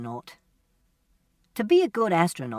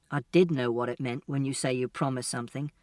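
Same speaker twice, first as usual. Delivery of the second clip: ordinary talk, nothing marked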